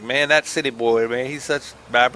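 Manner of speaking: lightly put-on rural farmer accent; mocking country cousins. Vlogger imitates his cousins mocking him, lightly put-on country accent. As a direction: tease the city boy like a country cousin. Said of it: "they don't talk like that".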